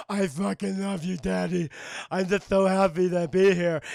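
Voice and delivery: loud lisping monster voice; heavy lisp. Retold or imitated. Deformed Clayface posing as son, lisping happily. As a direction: lisp every s like th, as in "so". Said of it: "He has this lisp".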